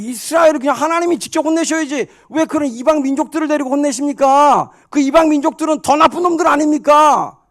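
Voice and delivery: loud, high outraged challenge to God; acted prophet arguing at the top of the voice; heated protest. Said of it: "핏대 올리면서 막".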